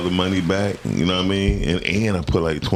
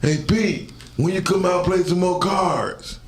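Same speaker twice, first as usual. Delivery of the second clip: much higher, slow called-out invitation; neighbor calling; 12 semitones higher, slower than usual. Retold or imitated. The neighbor guy calling Pete to play cards, high and slow. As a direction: call out to him across the street. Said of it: "the guy down the street said".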